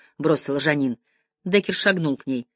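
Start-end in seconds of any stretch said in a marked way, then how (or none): none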